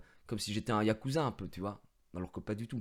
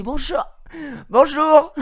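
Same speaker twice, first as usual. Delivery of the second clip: loud greeting; 13 semitones higher, 13 dB louder, much slower than usual. Retold or imitated. Greeting silent staff at Tesla.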